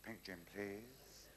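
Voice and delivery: low-key, lingering character voice; joke customer's order. Acted. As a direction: stretch out a gentle bar order. Woofter orders again next night.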